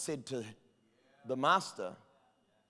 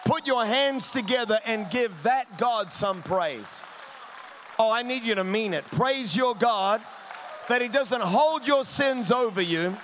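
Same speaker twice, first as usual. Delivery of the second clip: raised, emphatic sermon delivery; full-force preaching; 8 semitones higher, 10 dB louder than usual. Real, not acted. Preacher urging church to praise a forgiving God, impassioned. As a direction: drive it home to the whole congregation.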